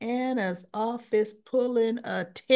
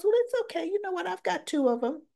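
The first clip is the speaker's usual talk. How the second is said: much higher playful imitation of Dex; light high voice for Dex; 10 semitones higher, faster than usual. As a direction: toss it off lightly in a raised voice.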